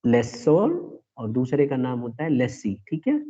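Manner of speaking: usual speaking voice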